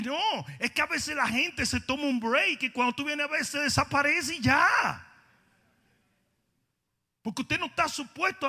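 fiery preaching. Acted. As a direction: preach it with full fire to the congregation. Preaching at full force.

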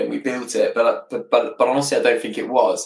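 ordinary delivery

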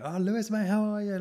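a hammed-up pub greeting; mock cheery greeting. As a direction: greet an old mate with put-on delight. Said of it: "you know".

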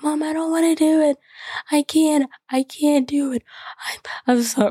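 high, breathy imitation of a panicking child; bawling little girl. Little girl begs mom off the roller coaster, imitated bawling. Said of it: "bawling her eyes out ... she's hyperventilating".

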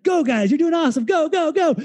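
now really cheering the workers on, full of excitement; all-out cheering. Retold or imitated. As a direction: throw everything into rooting for them. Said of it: "No, really give it to him".

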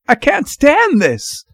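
high, drawn-out desperate cry; strained, overwrought outburst; hoarse, desperate cry. A narrator's reading. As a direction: cry out at breaking point. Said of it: "he cried hoarsely".

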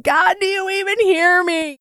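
high-pitched, exasperated cry to God; frustrated plea. Retold or imitated. Podcaster replaying her prayer after recording failed, frustrated plea. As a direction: plead upward in retold exasperation. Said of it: "but I'm so frustrated".

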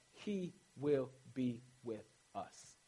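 hushed slow reassurance; tender promise. Acted. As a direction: let it land gently. Closing promise, slow and soft.

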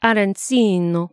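arancino said the Italian way, rolled r; Italian accent. Shown as a demonstration. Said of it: "which you have to say with an Italian accent".

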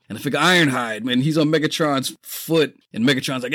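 ordinary delivery

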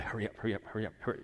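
hushed, hurried undertone; urgent inner voice. Retold or imitated. In his spirit, wanting to catch the woman, hushed urgency. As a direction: repeat it softly, like an urgent thought. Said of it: "in my, in my spirit".

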